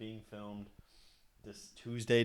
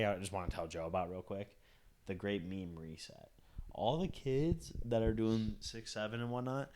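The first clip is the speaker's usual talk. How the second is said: usual speaking voice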